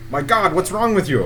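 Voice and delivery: a lightly voiced frightened man; alarmed NPC. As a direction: Felix rushing over in alarm. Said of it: "he turns and he looks and he says".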